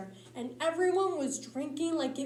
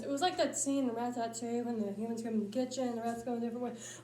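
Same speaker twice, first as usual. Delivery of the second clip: normal talking voice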